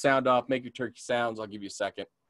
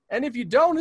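normal talking voice

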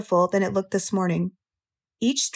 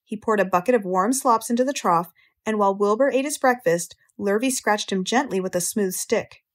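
ordinary delivery

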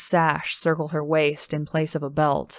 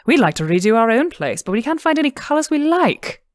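higher, louder eager pleading; eager plea; 8 semitones higher, 5 dB louder than usual. A narrator's reading. Woman asking Lindsay to paint, eager and higher. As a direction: beg her eagerly for help.

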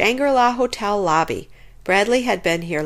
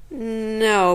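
ordinary delivery

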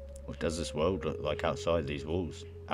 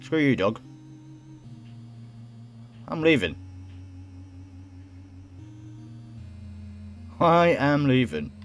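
raised voice, insisting stubbornly; defiant outburst; 8 semitones higher than usual. Acted. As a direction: shout down the dog defiantly. Defying the dog at the door.

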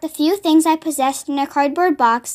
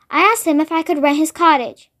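plain reading voice

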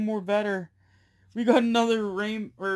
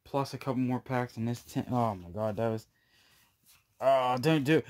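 ordinary talk, nothing marked

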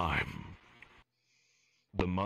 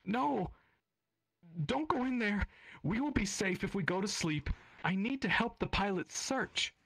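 put-on crying little girl. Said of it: "says louder, starting to cry".

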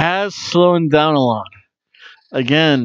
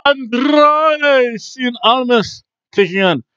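ring announcer; 9 semitones higher, much slower than usual. Pretend. Ring announcer style intro.

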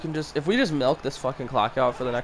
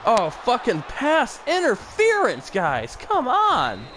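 angry yelling; 9 semitones higher than usual. Real, not acted. Fan watching on TV yells at the ref in fury. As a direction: blow up at the referee over the call.

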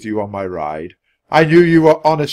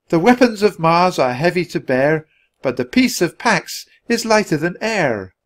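put-on young girl reciting; 6 semitones higher than usual. Annie recites her poem.

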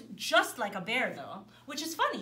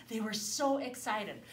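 ordinary delivery

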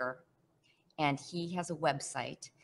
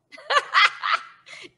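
a big high burst of laughter; real giggle breaking out; 12 dB louder than usual. Real, not acted. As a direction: laugh out loud, delighted. Delighted laugh recalling the karaoke.

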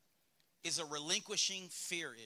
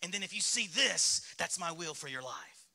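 Facebook Riddler madman; 4 dB louder than usual. Acted. God as madman posting on Facebook, hammed-up taunt. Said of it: "Facebook Riddler madman".

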